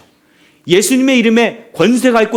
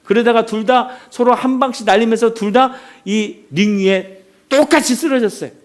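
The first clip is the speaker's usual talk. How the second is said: ordinary delivery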